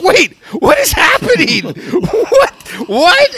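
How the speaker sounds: high, loud, disbelieving; laughing in shock; what is happening, up high; stunned. Retold or imitated.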